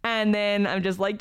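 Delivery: ordinary talk, nothing marked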